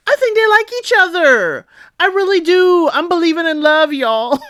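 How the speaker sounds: excited hope. Real, not acted. Host gushing to viewers about the couple, high excited hope.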